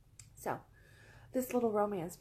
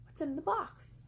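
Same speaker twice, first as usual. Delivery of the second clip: curious bird; 7 semitones higher, 4 dB louder than usual. Acted. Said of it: "the bird says to the man".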